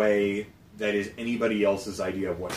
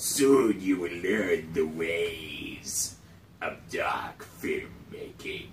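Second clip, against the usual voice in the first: evil emperor voice; 5 semitones lower, 4 dB quieter, much slower than usual. Pretend. Slow sinister emperor voice.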